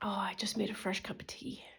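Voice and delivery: hushed whisper. Real, not acted. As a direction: whisper it close to the mic. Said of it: "unvoiced whisper".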